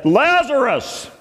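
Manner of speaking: commanding slow call "Lazarus!"; loud call. Retold or imitated. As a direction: summon "Lazarus!" as if to raise the dead. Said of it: "spoke in a loud voice".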